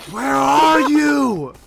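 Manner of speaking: long, drawn-out yell; loud mock shout. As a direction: bellow it out like calling far. Friend mimics the call at full shout.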